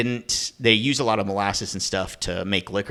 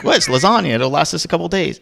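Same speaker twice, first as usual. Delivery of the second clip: defensive disbelief; 8 semitones higher, 6 dB louder than usual. Retold or imitated. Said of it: "I was like".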